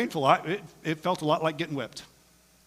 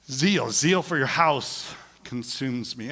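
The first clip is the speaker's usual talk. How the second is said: slow, solemn reading; solemn quote; 3 semitones lower, slower than usual. Shown as a demonstration. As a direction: let the verse sink in. Quoting scripture slowly.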